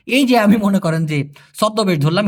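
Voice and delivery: ordinary talk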